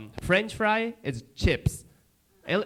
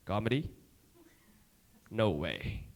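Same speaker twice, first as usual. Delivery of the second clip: low, flat disbelief; doubtful; 6 semitones lower, 7 dB quieter than usual. Retold or imitated. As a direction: scoff at it quietly. Officer doubting, quoted.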